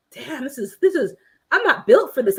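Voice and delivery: high, exasperated giving up; mock despair. Viewer acting out giving up on hammering, exasperated. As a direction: groan it like the job beat you. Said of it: "you're like".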